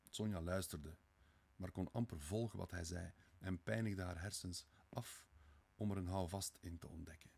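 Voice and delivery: ordinary delivery, nothing marked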